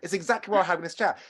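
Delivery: ordinary delivery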